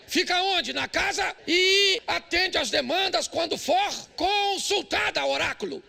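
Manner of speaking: telling the church where the mother-in-law belongs, loud and slow; emphatic preaching. Acted. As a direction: hammer each word home.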